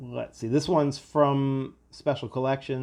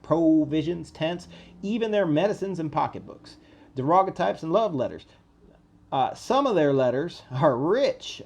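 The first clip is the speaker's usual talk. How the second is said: put-on Southern accent; 2 semitones higher than usual. Confederate soldier writing to his cousin, in a Southern accent. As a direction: read it like a proud Southern soldier. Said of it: "I'll try and put on a Southern accent".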